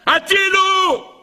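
high, drawn-out scream for help; dove's cry for help. Retold or imitated. The trapped dove screams to be saved, high and slow. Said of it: "צועקת".

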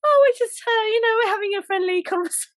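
high, falsetto mock-innocent excuse; high squeaky fake innocence, speeding up. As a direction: squeak it out like a caught kid, then rush it. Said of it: "it was like".

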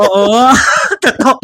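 high, loud laughing burst; real giggling laugh. Real, not acted. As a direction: crack up at the memory. Laughing at silly answer.

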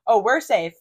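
loud bright blurt; really loud. Retold or imitated. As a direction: say it loud, caught off guard. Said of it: "Like, really loud".

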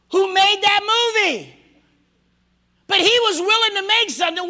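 loud, high preaching shout; full-force preaching. Acted.

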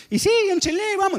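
a hint of a high old farmer's voice; Don Luis. Old farmer welcoming the visitors, lightly voiced high. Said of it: "Y me dice".

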